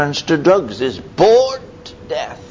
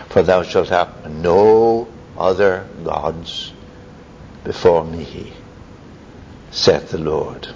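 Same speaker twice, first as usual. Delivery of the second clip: slow, low, solemn recitation; solemn scripture quote; 7 semitones lower, slower than usual. Acted. Preacher quoting God's commandment, slow and solemn. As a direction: intone it like holy writ.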